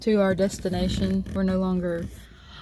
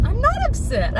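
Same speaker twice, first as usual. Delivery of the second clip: high-pitched denial; 14 semitones higher than usual. Real, not acted. Teased about being upset.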